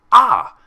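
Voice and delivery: loud, high sudden exclamation; eureka cry. A narrator's reading. Pinkie about to voice another theory, loud high cry. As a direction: blurt out a new idea. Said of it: "she declared".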